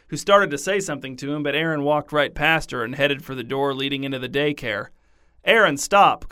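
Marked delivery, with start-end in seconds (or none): 5.4-6.3 louder, higher call to stop. Acted. Teacher calling a boy heading for the door, louder. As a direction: call after him across the playground. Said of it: "called Miss Dot".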